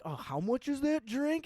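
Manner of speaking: high-pitched, fussy mock customer; mock shocked customer. Bartender imitating a customer balking at prices, mock shocked.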